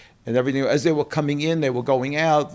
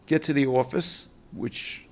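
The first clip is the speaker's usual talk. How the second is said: usual speaking voice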